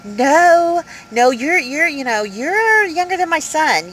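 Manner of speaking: high, drawn-out retold "no"; incredulous refusal. Retold refusal to young man.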